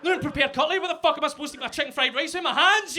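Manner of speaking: loud, high, rushed comic rant; mock outrage. Customer to takeaway delivery, hammed-up fast angry yelling. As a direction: rattle off an indignant complaint. Imagined rage at takeaway order.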